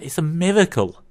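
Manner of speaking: high, mock-awed exclamation; lightly acted devout cowboy marvelling; praising God. Devout cowboy praising God for his found Bible, mock wonder. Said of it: "looks up to the sky and praises God".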